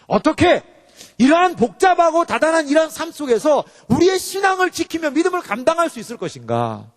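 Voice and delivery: high, forceful pulpit declamation; fervent sermon delivery; impassioned preaching. Real, not acted. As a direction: proclaim it, stretching the key words.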